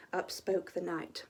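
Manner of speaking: ordinary delivery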